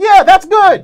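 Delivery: loud, high cheering; retold approval. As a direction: cheer on a great performance. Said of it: "And I was like".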